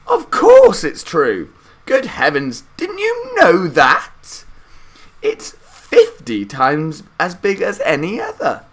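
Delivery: put-on high, loud and slow old folks exclaiming; excited old grandparents. A narrator's reading. Grandparents telling Charlie the factory is huge, high loud old voices. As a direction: insist like amazed old people. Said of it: "cried all four of them at once".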